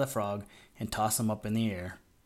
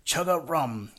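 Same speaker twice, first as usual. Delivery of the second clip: a second joyful call, higher but less loud; glad cry; 4 semitones higher, 3 dB louder, slower than usual. A narrator's reading. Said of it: "he cried again".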